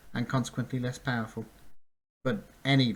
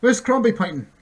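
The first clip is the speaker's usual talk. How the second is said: calling out; 11 semitones higher, 11 dB louder than usual. Acted. Fleeing on centaur's back.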